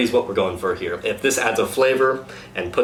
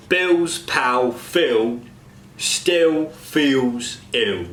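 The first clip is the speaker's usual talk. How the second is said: slow Cockney "Bill's pal Phil still feels ill" with vowelled L; drawn-out Cockney, L sounds swallowed; Cockney example sentence; 3 semitones higher, much slower than usual. Shown as a demonstration. Coach demos Cockney L sentence to cast. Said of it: "Example sentence".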